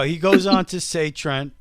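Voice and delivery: ordinary delivery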